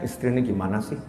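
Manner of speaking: very soft, low husband's complaint; aggrieved husband thinking to himself, hushed; inner grumbling. Acted. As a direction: grumble privately under your breath. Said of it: "di benaknya, di hatinya".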